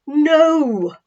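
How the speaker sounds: loud, firm chorus of no; emphatic no. A narrator's reading. Everyone not in Jerusalem answering Salome, emphatic. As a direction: stamp it down hard. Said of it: "most emphatically".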